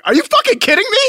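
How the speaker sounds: very high, loud outraged refusal; mock exasperation. Friend refusing to be the wingman, high mock outrage. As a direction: loudly imitate a buddy's indignant squawk.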